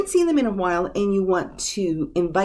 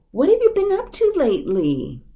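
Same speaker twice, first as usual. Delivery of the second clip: high, loud, delighted question; eager catching up; 8 semitones higher, 5 dB louder than usual. Shown as a demonstration. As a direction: greet an old friend you've missed, voice up. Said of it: "make that connection again".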